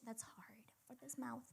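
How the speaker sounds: soft breathy whisper to self; whispered aside. Real, not acted. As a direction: whisper it half to yourself. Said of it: "whispered aside".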